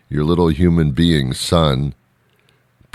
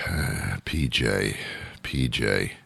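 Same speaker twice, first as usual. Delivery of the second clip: usual speaking voice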